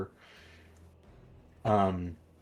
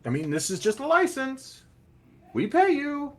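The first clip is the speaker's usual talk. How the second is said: much higher voice for a game character; non-player character; 5 dB louder than usual. Acted. Game character explains payment to the crew, high-pitched.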